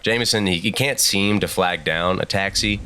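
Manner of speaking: ordinary talk, nothing marked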